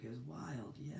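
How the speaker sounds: whispered aside; hushed little aside. Real, not acted. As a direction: drop to a secret whisper.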